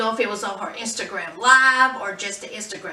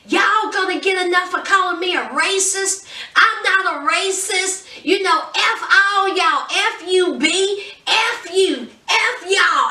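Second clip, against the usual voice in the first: imitating an angry rant; 7 semitones higher than usual. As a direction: mimic her going off in a high angry voice. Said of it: "was just going off".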